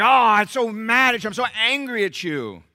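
loud, high, exaggerated mad outburst; hammed-up fury; mock anger. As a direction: voice the sulking rage. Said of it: "and we're like".

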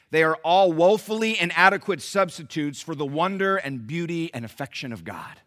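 usual speaking voice

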